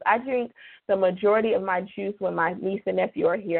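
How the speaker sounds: ordinary talk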